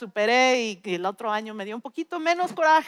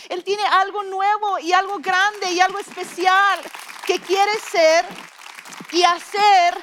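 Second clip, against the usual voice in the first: high, louder preacher voice; fervent preaching; 6 semitones higher, 4 dB louder than usual. Acted. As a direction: exhort the church to move on. Preaching at full force. (predicación ferviente y más fuerte)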